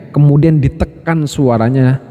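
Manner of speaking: ordinary talk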